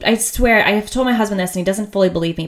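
normal talking voice

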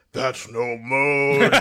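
slow, drawn-out movie quote; Godzilla line. Retold or imitated. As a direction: drag it out like a monster's only line. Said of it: "the only words Godzilla ever utters".

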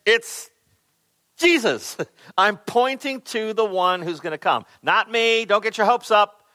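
high, forceful pointing away from himself; emphatic. Retold or imitated. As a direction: insist emphatically, pitched well up. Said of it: "He is so emphatically saying".

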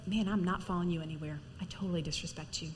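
low, muted mock contempt; disrespectful tone. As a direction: quietly act out a dismissive, disrespectful wife. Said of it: "the tone of voice ... it says".

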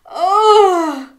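loud, high crab groan; dizzy groan. A narrator's reading. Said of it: "groaned the crab dizzily".

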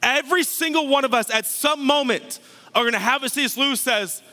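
normal talking voice

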